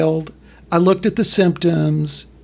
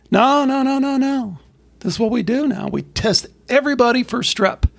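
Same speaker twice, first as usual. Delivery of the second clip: higher, dismissive mock official; pitched up, brushing off the parents; insisting official; 7 semitones higher than usual. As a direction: official waving off parent complaints.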